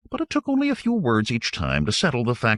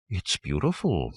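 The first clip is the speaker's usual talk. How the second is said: low queenly voice; 5 semitones lower, 5 dB quieter than usual. A narrator's reading. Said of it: "in her low voice".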